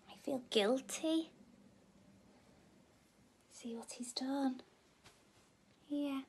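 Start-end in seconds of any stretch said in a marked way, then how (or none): 3.5-6.2 soft whispered aside; hushed, conspiratorial whisper. Real, not acted. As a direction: whisper like sharing a secret. Whispered comments on guilty page.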